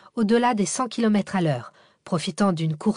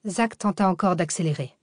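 usual speaking voice

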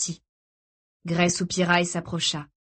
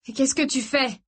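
understated dread, pitched up; voix blanche; 6 semitones higher than usual. A narrator's reading. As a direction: ask it in a drained, anxious voice. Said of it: "d'une voix blanche". (d'une voix blanche)

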